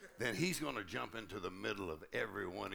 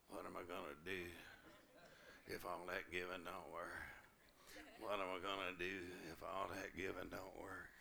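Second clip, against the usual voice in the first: nervous; 2 semitones lower, 9 dB quieter than usual. Retold or imitated. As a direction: mutter it anxiously. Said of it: "I kinda got nervous".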